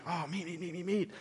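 greedy mock craving. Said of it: "they're so like".

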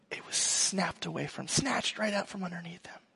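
hushed whisper to land the loss. Real, not acted. Preacher telling the congregation of his son's loss, whispered. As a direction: drop to a whisper for the heartbreak. Sad climax of son's story.

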